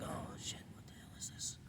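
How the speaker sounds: hushed, breathy whisper of alarm; whispered panic. Retold or imitated. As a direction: whisper it like a startled secret. Baby's inner panic, quoted.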